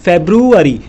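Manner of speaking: फेब्रुअरी said as febru-ari, English style; English pronunciation. Shown as a demonstration. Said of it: "इसका pronunciation होता है फेब्रुअरी".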